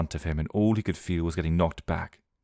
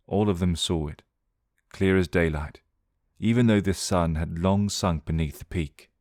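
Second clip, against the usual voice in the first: ordinary delivery, nothing marked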